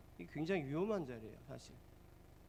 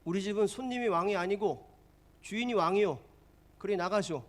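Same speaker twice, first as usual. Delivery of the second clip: owner shouting, louder and higher; defiant owner; 4 semitones higher, 7 dB louder than usual. Retold or imitated. Restaurant owner telling complaining customer he is king, loud defiance. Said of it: "이 주인도 만만치 않아요".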